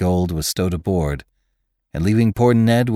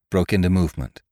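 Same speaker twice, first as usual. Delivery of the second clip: ordinary delivery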